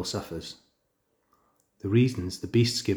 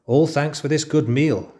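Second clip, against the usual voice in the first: fawning jackal; 6 semitones higher, 6 dB louder than usual. Acted. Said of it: "he said, licking his lips".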